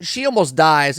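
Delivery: ordinary talk, nothing marked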